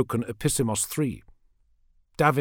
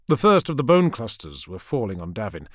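ordinary delivery

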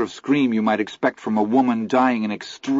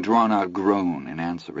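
usual speaking voice

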